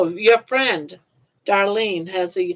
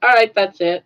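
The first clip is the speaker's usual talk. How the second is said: mock resolve; 7 dB louder than usual. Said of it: "and I was like".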